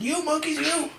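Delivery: high, drawn-out storybook scolding; mock angry. A narrator's reading. Angry peddler shakes both hands at monkeys, mock angry scolding. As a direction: scold crossly, stretching out the demand. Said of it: "Yeah, he's angry".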